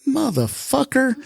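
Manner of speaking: high-pitched, disbelieving swear; retold outburst of anger; exasperated curse. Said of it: "here's my honest thing".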